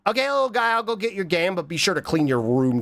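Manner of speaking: usual speaking voice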